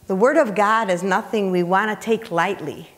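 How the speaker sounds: ordinary talk, nothing marked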